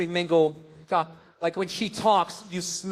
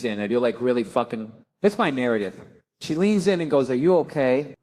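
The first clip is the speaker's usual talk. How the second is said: mock reverent narration; 9 semitones lower than usual. Mock-epic lowered voice.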